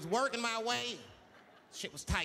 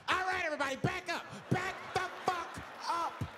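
flipping out; 12 semitones higher than usual. Retold or imitated. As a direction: bark like a cornered creep losing it. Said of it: "Now the guy flips out".

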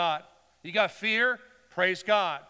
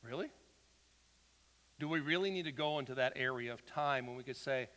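ordinary talk, nothing marked

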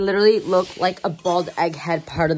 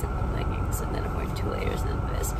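whisper; 5 dB quieter than usual. Real, not acted. Lists her layers in a whisper.

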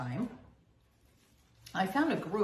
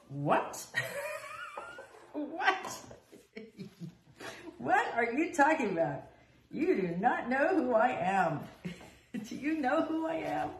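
incredulous, laughing what, much higher; outraged disbelief; 10 semitones higher than usual. Retold or imitated. Told her ego is the problem, laughing indignant disbelief. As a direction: laugh it off in offended shock.

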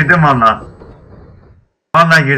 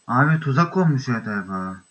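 normal talking voice

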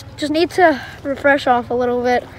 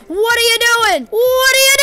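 higher, louder what are you; outraged; 7 semitones higher, 6 dB louder than usual. Real, not acted. Gamer yelling at an enemy player, an outraged shout. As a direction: call out the player in disbelief.

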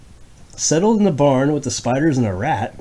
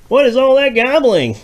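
high loud startled farmer; crying farmer; 14 semitones higher, 8 dB louder than usual. Acted. The farmer crying out from bed, high and loud. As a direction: cry out from bed. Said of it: "cried the farmer in his bed".

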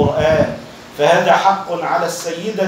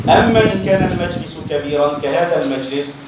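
usual speaking voice

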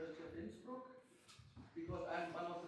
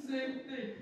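laughing; 8 semitones higher, 4 dB louder than usual. Real, not acted. Still laughing after the joke.

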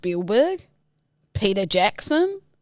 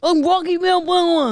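mushy slurred Rocky; badly slurred Stallone voice; Rocky impression; 7 semitones higher than usual. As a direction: slur it like a bad Rocky. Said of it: "sounded like Rocky if he was deaf".

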